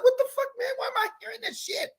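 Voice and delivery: sudden high-pitched disbelief; retelling his own shock at the song stuck in his head; baffled outburst. Retold or imitated. Man telling listeners about the song, mock exasperated outcry. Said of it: "I was like".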